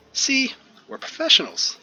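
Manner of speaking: high, playful and smug; teasing team leader. Acted. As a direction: rub it in playfully. Monica winks, voiced by GM.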